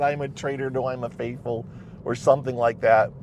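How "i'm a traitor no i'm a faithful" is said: ordinary delivery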